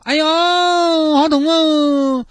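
high, slow hammed-up whimper; milk the injury for the handsome man; fake pain. Girl faking pain to attract the handsome man, hammed-up high whimper. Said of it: "我痛的还不够妩媚".

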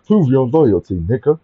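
retold catchphrase. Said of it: "so I was like".

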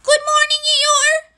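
high, loud call across a distance; high-pitched small-creature holler; Piglet shouting. A narrator's reading. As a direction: shout a greeting in a piercingly high little voice. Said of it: "shouted Piglet".

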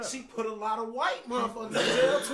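loud, laughing protest; defending Kamala with a loud laugh; laughing outburst. Real, not acted. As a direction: burst out laughing while making the point.

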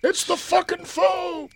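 very high, slow comic cry; mock alarmed squeal. Punchline of the sentry bit.

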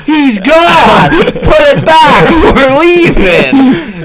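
high, very loud, stretched-out yell; hammed-up comic outburst; retold exasperated shouting. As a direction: holler it, breaking into laughter at the end. Retold shouting at Paul to stop.

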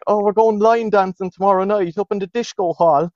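put-on high Wexford girls' voice; mock Wexford accent. Girls announcing their evening plans, mock Wexford accent. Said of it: "they had that horrible accent".

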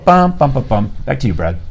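ordinary delivery

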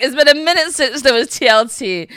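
high, loud acted-out excitement; gleeful retold reaction. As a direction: relish the drama as you quote yourself. Repeats own gleeful reaction to drama.

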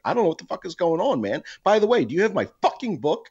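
high, loud, fed-up rant; half-joking outburst at a friend who won't return a book; mock exasperated yell. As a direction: throw your hands up and demand the book back. Exasperated demand to friend, retold.

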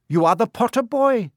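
centaur rescuer. Acted. Centaur recognises the boy he rescued, high-voiced. Centaur figure speaking to Harry.

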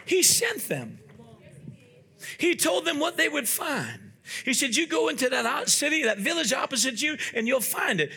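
high-pitched, insistent preacher's retelling; emphatic preaching. Real, not acted. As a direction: hammer out each phrase, slowing for the final one. Preacher retells scripture with force.

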